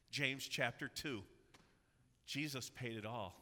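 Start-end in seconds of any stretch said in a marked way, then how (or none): none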